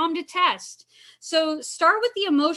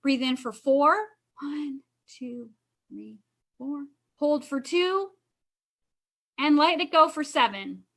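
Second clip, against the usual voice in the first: guided breathing count. Shown as a demonstration. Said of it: "Okay, so I'll count".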